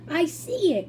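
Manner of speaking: high, delighted cry; spotting the house with glee; excited cry. A narrator's reading. Mister Wonka crying out as he spots Charlie's house. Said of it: "cried Mister Wonka".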